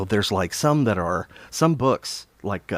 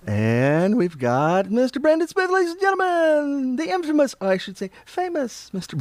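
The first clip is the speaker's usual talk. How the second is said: mock announcer; 13 semitones higher than usual. Streamer presenting a regular viewer, mock grand announcer. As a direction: introduce him like a ringmaster, playfully.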